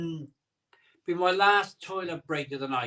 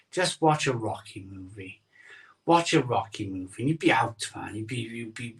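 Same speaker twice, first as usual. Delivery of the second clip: ordinary delivery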